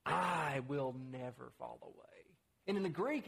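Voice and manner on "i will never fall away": "I" drawn out and stressed, quoting Peter; emphatic I. Retold or imitated. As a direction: lean hard and long on the opening "I". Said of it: "it's very emphatic".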